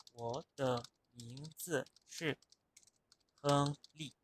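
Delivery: "我的名字是 亨利" en mandarín, despacio y con tonos; chino mandarín lento. Shown as a demonstration. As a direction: pronunciar "我的名字是 亨利" sílaba a sílaba en mandarín. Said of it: "si yo quiero decir algo como". (demostración lenta en mandarín)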